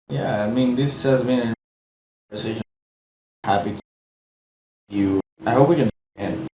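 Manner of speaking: choppy, broken-up audio with dropouts; speech glitching and dropping out on a bad mic; mic cutting out. Real, not acted. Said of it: "I think your mic's cutting off".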